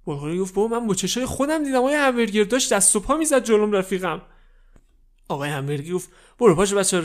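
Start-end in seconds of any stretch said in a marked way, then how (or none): 0.5-4.2 high, loud pleading insistence; upset insisting. Acted. Kid insists again. 6.4-7.0 loud irritated order; angry snap. Acted. Boss snaps at kid.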